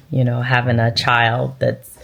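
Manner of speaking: ordinary delivery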